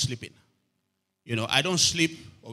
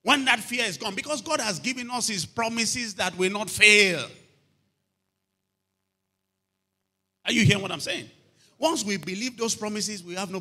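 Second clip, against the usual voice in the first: loud, high preaching push; fiery preaching; 4 semitones higher, 7 dB louder than usual. Acted. Pastor preaches loudly to the congregation against fear. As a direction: drive it home to the congregation.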